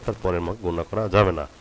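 ordinary talk, nothing marked

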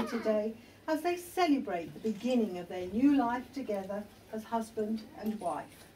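measured, solemn registrar's address; formal ceremony speech. Acted. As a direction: officiate the wedding with dignity. Registrar conducts wedding ceremony.

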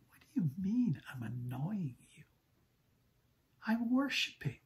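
indignant whisper, pitch jumping up at the end; inner thought, whispered. Retold or imitated. Husband whispers his indignant inner reply to wife. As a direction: hiss your private protest. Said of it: "I thought".

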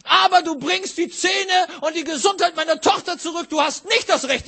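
loud, high, defiant order to the devil; fierce spiritual rebuke shouted; commanding rebuke. Retold or imitated. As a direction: command the devil to give it back. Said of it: "drehte mich um ... und sagte".

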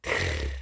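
Трррр with a long rolled р; trilled trrr. Shown as a demonstration. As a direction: roll Трррр out from т. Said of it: "від звука Т". (довге розкотисте Трррр)

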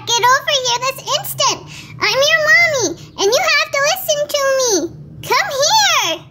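high-pitched scolding cat mommy; play-acted motherly telling-off; bossy mommy. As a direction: order the pet back, high and insistent. Mother figure orders cat back.

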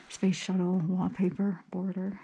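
normal talking voice

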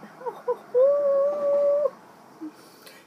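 loud, very high falsetto whoop-laugh; delighted high hoot. Real, not acted. Vlogger thrilled at the blush, loud hooting laugh. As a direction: let out a long, loud hoot of glee. Delighted noise on seeing product.

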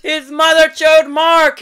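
high, loud, drawn-out hype introduction; hype announcer. Pretend. Mocking the scammer by hyping his name, loud and drawn out. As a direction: announce him like a wrestler entering the ring. Caller hypes the scammer's arrival.

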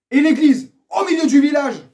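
high, loud proclamation; emphatic shout. Real, not acted. Vlogger setting things straight, emphatic shout. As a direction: belt it out to settle the matter. Forceful punchline of rant.

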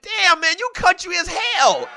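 high-pitched, incredulous ribbing; playful, high-pitched razzing; mock teasing exclamation. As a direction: exclaim it like teasing an old roommate. Retelling his teasing of roommate.

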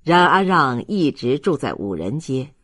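ordinary delivery

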